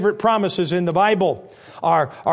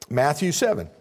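normal talking voice

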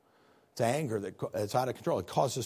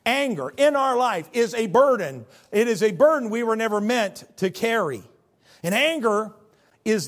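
raised, higher preaching voice; fiery pulpit emphasis; preaching loud; 6 semitones higher, 6 dB louder than usual. Acted. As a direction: drive it home from the pulpit. Preaching at full force.

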